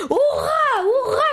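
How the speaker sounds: high, loud cheering shout; joyful shout. Acted. The teacher cheers after hugging Monsieur Ronchon, shouting high. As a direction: yell hurrah with pure delight. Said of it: "cria-t-elle".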